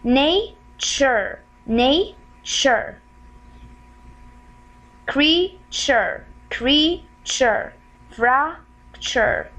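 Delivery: each word split, first half rising, then a falling "chur"; "Nature", "Creature", "Fracture" slowly broken in two; syllable-by-syllable demo. Shown as a demonstration. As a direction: stretch both syllables with a clear gap between. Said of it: "This is the chur sound".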